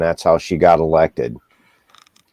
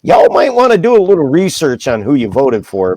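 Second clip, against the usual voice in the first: exasperated plea; 11 semitones higher, 4 dB louder than usual. Retold or imitated. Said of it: "And I'm like".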